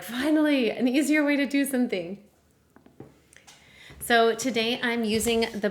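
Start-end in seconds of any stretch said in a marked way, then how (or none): 0.0-2.2 happy brain. Retold or imitated. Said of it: "your brain is so happy because they're like".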